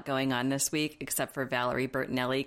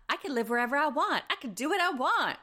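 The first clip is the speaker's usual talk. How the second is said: put-on higher whiny teenage defiance; bratty teen voice; 10 semitones higher than usual. Podcast host mocks Avery defying her mom, higher whiny. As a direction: play the sulky daughter talking back.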